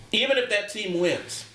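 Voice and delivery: normal talking voice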